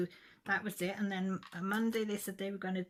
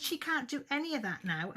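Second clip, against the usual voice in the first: higher and louder, reliving the fury at the nurse; retold furious outburst; 9 semitones higher, 5 dB louder than usual. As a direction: snap it back at her like you did that day. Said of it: "Well, I lost it".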